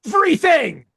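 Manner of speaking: high, loud eager yell; eager shout. Retold or imitated. Begging the distributor for promo stuff, an eager shout. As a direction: demand it all at once, thrilled. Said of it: "And I was like".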